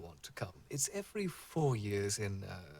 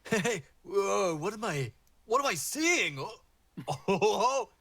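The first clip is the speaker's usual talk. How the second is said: high, excited surfer-dude voice; excited surfer Triton; 10 semitones higher, 5 dB louder than usual. Acted. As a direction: gush like a stoked surfer seeing a legend. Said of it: "Triton that was out there goes".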